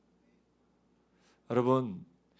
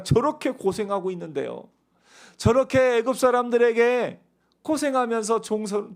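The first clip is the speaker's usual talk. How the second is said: complaining to God; 6 semitones higher, 4 dB louder than usual. Retold or imitated. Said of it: "하나님 앞에 원망하면서 그렇게 이야기하는".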